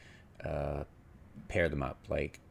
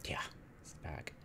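whisper; 14 dB quieter than usual. Real, not acted. Muttering to self over replay.

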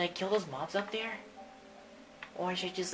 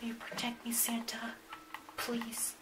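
whispered plea; 7 dB quieter than usual. Acted. Timid plea to Santa.

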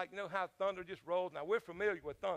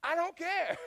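high, loud imitated exasperation; exasperated retort; 10 semitones higher, 8 dB louder, slower than usual. Husband answering his wife about her hair, exasperated. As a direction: snap back in mock exasperation. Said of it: "I'm like".

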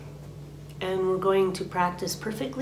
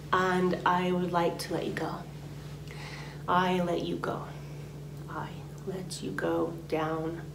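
soft unvoiced whisper, fading to almost nothing; whispered release; 9 dB quieter than usual. Shown as a demonstration. Teacher whispers the letting-go words, softer and softer. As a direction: breathe the words out as you let it go. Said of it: "You can say it out loud".

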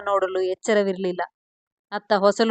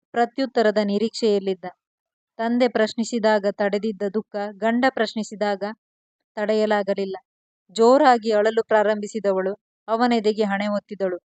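ordinary delivery, nothing marked

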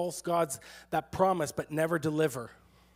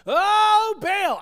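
very high, loud wail to a god; hammed-up worshipper crying out at the top of the range; louder mock shout; 11 semitones higher, 6 dB louder than usual. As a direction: imitate a frantic chant, pitched right up. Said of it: "that they made louder".

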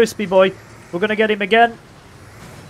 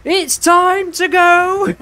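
very high, louder drawn-out holler; high battle cry; 12 semitones higher, 5 dB louder, much slower than usual. Real, not acted. Gamer going for the kill, high stretched battle cry. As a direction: belt it high, stretching each word.

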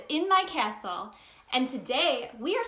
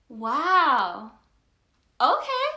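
hammed-up surprise, pitched way up; amazed; 6 dB louder, much slower than usual. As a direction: play it big for a child. Reacting to child's big savings.

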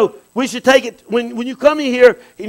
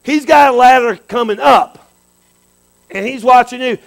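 ordinary talk

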